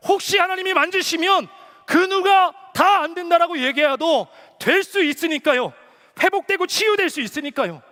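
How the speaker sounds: high, loud, forceful sermon delivery; impassioned preaching. Real, not acted. Preacher telling the congregation why they must serve, impassioned preaching. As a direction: drive each question and answer home to the congregation.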